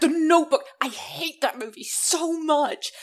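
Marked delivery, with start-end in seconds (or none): 0.0-0.6 louder and higher, spitting out the title; disgusted exclamation. Real, not acted. Friend mocks the movie title with loud disgust. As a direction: groan out the film title in disgust.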